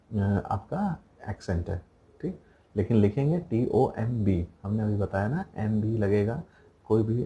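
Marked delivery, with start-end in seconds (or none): none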